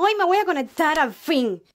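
ordinary delivery

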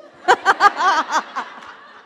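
high, loud burst of laughter. Real, not acted. Speaker after her made-up Aromat joke, loud laugh. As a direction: crack up at your own line.

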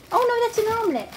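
high coaxing pet voice. Real, not acted. Owner coaxing her rat out of hiding, high baby talk. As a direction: coax her out, sweet and high.

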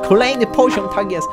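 thrilled shout of victory; excited. Real, not acted. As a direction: cheer the level up. Levels up in game.

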